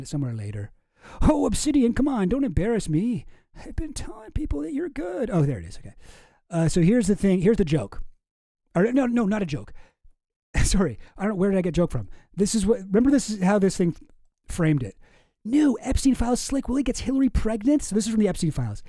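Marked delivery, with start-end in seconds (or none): 1.2-5.3 high-pitched exasperated plea; mock pleading. Streamer begging a note app not to embarrass them, mock pleading. 15.4-17.8 high, loud tabloid announcement; mock headline read. Streamer rereading how the post framed it, mock sensational.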